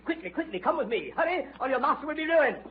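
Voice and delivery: high, breathless hurry; urgent pleading. Acted. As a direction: rush them out the door. Detective begs them to hurry.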